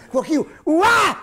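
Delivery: loud high yelp as the plane shakes; hammed-up turbulence scream; mock scream. Another jolt.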